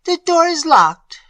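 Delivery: high, loud lady's reply; a trapped lady stating the obvious; distressed aunt. A narrator's reading. Aunt answers through locked door.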